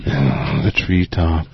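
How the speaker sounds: low, very slow lullaby croon; mock soothing voice. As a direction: playfully lull them to sleep, drawn out. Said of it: "that just lulls them to sleep".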